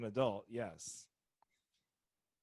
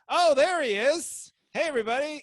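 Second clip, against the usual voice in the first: club host's call-out; 12 semitones higher, 11 dB louder than usual. Retold or imitated. Host on stage spots the late comic walking in, excited call-out. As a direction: hail the arriving comic to the whole room. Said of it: "and they're like".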